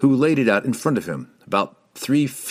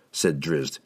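ordinary delivery

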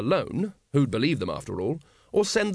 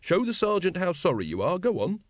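higher, pointed order; sharp; 6 semitones higher than usual. A narrator's reading. Carrot making the librarian apologise, sharply. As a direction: prod him into apologising. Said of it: "said Carrot sharply".

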